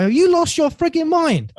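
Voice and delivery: high, exasperated imitation of dad; mock exasperated dad. Dad telling his son he has lost his mind, high mock exasperation. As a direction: squeal it like he can't believe it. Dad scolding son's career move.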